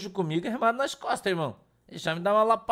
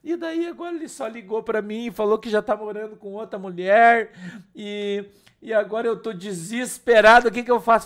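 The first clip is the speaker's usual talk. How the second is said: imitating weeping woman; 3 semitones higher, 4 dB louder than usual. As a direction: plead like a distraught woman, voice pitched up. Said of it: "E ela falou assim".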